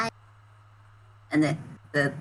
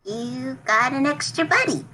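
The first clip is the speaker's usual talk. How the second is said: lightly voiced sweet character, higher pitch; a touch of delight, pitched up; 4 semitones higher than usual. [name] to a new friend, delighted.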